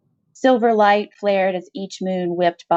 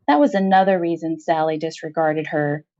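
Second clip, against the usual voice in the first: ordinary delivery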